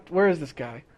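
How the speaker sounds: usual speaking voice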